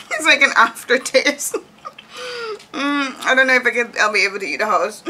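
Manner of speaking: half-crying with laughter; laughing while talking. Real, not acted. Laughing through stale cookie to her viewers, loud and high.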